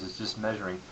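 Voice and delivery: usual speaking voice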